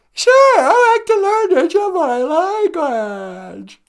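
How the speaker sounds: very high, loud squeaky voice; eager sidekick pupil. Acted. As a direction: agree eagerly in a tiny puppet voice. Sidekick agrees to a language lesson.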